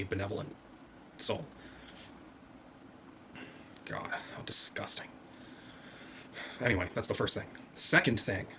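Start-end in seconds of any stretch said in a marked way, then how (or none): 3.8-5.1 breathy whispered aside, low and soft; disgusted whisper. Real, not acted. Commenting on a thumbnail, a disgusted whisper. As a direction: hiss it under your breath in disgust.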